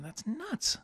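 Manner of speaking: high, amazed exclamation. Real, not acted. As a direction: marvel at it. Reacting to surgeon turned driver.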